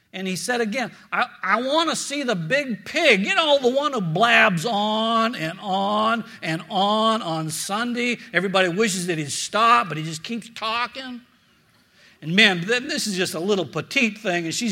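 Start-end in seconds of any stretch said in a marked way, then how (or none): 1.1-11.2 a hint of a Texan drawl, a bit louder; lightly voiced Texan cowboy asking for the pastor. Preacher doing a tall Texan at the church office, light Texan drawl. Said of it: "he had this Texan drawl".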